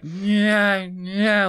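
high imitated engine whine; muffled racing car noises. Boy at his desk making car noises, muffled engine whine. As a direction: imitate a kid's muffled vroom. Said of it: "making noises like".

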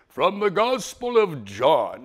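slow, loud, mock-solemn liturgical intoning; imitating the priest announcing the reading.

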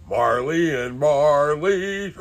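hammy ghostly wail. Acted. Christmas Carol ghost bit.

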